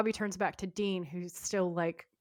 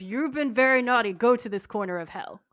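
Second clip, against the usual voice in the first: put-on raised, louder telling-off, as to a naughty child; mock scolding; 3 semitones higher, 6 dB louder than usual. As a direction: play a scolding God, hammed-up. God scolding Lucifer in retelling.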